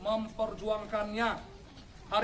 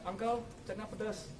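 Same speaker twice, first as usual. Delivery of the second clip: softer, hiding the tears; pretended excuse; 2 semitones lower, 8 dB quieter than usual. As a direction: mumble the excuse to the uncle, softer. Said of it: "Saya pura-pura bilang". (alasan pura-pura yang diucapkan lebih pelan)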